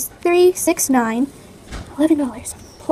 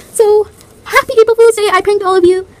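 loud, raised, triumphant announcement; belted-out gotcha; gleeful prank reveal; 7 semitones higher, 7 dB louder than usual. Real, not acted. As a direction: crow about the prank you pulled. Celebrates the prank on viewers.

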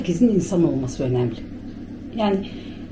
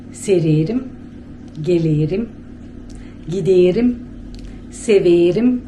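Bulgarian Turkish; slower than usual. Shown as a demonstration. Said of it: "Biz öyle konuşuruz".

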